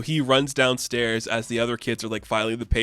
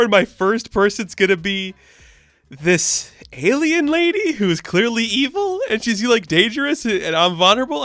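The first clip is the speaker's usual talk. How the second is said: put-on high, loud, flustered kid monologue; mock panic; 9 semitones higher, 4 dB louder than usual. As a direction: spiral into hammed-up nervous panic. Billy's panicked inner monologue, acted.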